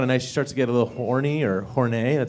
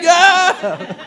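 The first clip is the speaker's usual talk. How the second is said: mock passenger echo; 5 dB louder than usual. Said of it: "He's like".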